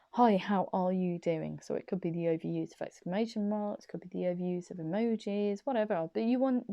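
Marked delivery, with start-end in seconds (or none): none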